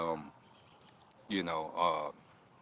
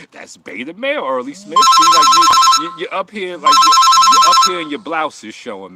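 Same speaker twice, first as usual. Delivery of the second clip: loud, higher mocking through laughter; laughing mockery; 7 semitones higher, 16 dB louder than usual. Real, not acted. Roasting a viewer on stream, loud laughing mockery. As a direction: roast him while cracking up.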